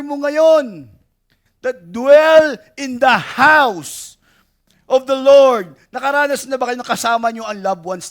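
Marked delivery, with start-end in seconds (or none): 1.6-5.8 slow, loud preaching of the line; building, slow pulpit call; loud proclaiming. Acted. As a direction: proclaim it out to the church.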